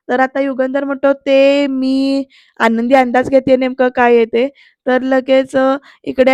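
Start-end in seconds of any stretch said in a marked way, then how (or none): 1.1-2.2 very drawn-out, stalling words; hesitant. Retold or imitated. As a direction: stretch it out as if unsure how to continue. Boss struggles to make his request.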